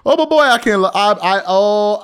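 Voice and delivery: high, loud burst of excitement; giddy anticipation. Real, not acted. Host relishing the coming exposure, excited. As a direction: stumble over the words with eagerness, voice up.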